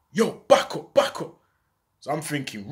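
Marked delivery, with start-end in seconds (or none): 0.1-1.3 louder, hostile warning to back up. Retold or imitated. Gunman hostilely orders the narrator to back up. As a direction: push him back with a threat. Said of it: "he started getting all hostile".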